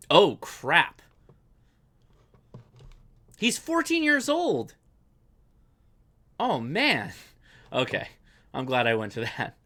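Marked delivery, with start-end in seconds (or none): 0.1-7.1 loud, high amazed outburst. Real, not acted. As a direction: blurt it out in disbelief. Reacting to viewer's video.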